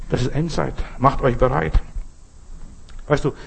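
normal talking voice

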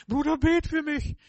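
high, loud mock plea from a worn-out brother; pleading believer. Weary believer begs for prayer. (laut und viel höher flehend, gespielt)

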